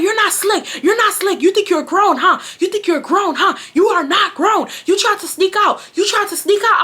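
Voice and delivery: high-pitched scolding mom imitation; arguing mom. Mom scolding a child caught sneaking out, arguing. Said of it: "going back and forth, like, arguing".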